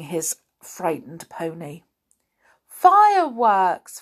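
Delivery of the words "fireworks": slow, loud delighted shout; pixie. Acted. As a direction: shout it with glee. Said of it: "shouted the angry pixie in delight".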